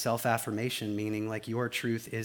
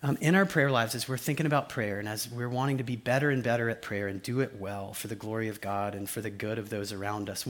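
ordinary delivery

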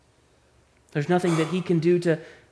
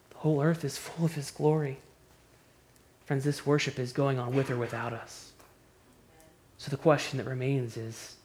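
soft, much quieter searching question to the congregation; quiet appeal; 5 semitones lower, 8 dB quieter than usual. Acted. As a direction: lower the voice and press gently.

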